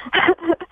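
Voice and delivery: high nervous giggle. Real, not acted. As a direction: giggle before speaking. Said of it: "I might be, like, giggling through this".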